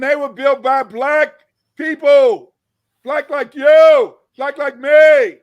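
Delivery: slow, loud, emphatic declaration; emphatic preaching. Real, not acted. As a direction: hammer each phrase home.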